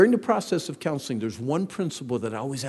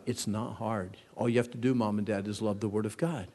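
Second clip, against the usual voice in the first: normal talking voice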